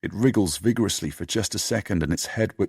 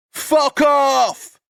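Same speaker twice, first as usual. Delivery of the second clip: very high, loud screaming curse; furious shout; 8 dB louder than usual. Acted. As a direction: scream it at him in rage. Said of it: "He's so upset he turns on Sid".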